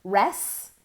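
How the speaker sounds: res said alone, cut before the final ts; split syllable. Shown as a demonstration. Teacher breaks the word rests into parts. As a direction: say just res, stopping short. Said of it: "saying on the one hand".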